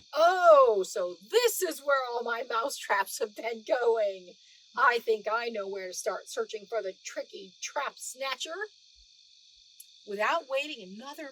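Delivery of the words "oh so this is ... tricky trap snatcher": loud, eager guard voice; a guard bursting out in triumph; excited discovery. A narrator's reading. Guard finding his stolen mousetraps, loud and excited.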